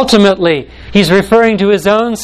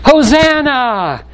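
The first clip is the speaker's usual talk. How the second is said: a slow, much higher acclaiming cry; crowd's cry; 8 semitones higher, slower than usual. Acted. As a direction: call it out like the jubilant crowd. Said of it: "and cried out".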